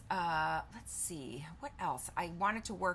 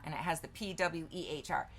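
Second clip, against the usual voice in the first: ordinary talk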